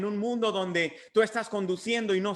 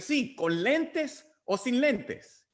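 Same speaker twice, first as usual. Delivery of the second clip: lightly voiced old man, higher and slow; old man insisting; 6 semitones higher, much slower than usual. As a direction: insist patiently, stretching each word. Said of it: "Y le dice". (anciano insistente, lento y agudo)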